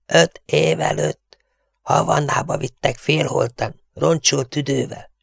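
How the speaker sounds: high, strangled narration; whispery distorted voice. A narrator's reading. The Tiger recounts being carried half-dead, choked voice. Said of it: "súgta a torszang".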